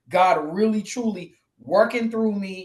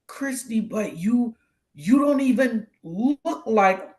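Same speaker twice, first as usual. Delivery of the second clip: higher, halting astonishment; blown-away disbelief; 4 semitones higher, slower than usual. Retold or imitated. Acquaintance stunned by her hidden hardship, incredulous. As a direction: imitate someone struggling to believe it. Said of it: "they were blown away".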